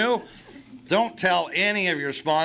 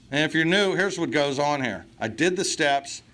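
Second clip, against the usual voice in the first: usual speaking voice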